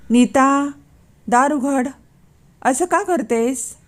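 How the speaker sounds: husband getting worked up, dragging out the question. A narrator's reading. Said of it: "नवीनचा आवाज चढला". (चढलेला आवाज)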